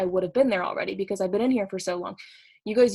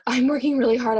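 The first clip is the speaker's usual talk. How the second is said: louder, higher protest of working hard; emphatic new recruit; 4 semitones higher, 6 dB louder than usual. Retold or imitated. New recruit protesting to her mentor, louder. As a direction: insist you're working really hard. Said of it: "they're like".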